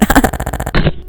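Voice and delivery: short burst of laughter. Real, not acted. Streamer after telling viewers to deal with it, laughing. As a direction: laugh at your own joke. Jokes about window, then laughs.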